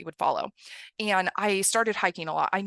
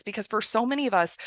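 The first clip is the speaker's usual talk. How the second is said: usual speaking voice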